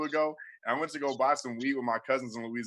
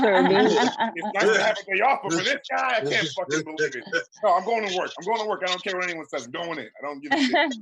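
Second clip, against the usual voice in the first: indignant ranter; 5 semitones higher, 5 dB louder than usual. Acted. Supremacist ranting about the day off.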